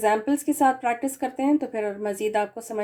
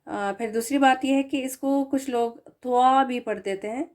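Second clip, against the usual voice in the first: ordinary talk, nothing marked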